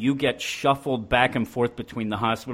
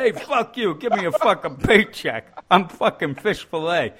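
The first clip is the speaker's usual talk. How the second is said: hammed-up thug; 5 semitones higher than usual. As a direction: bark it like a cartoon bully who can't keep a straight face. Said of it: "He's like".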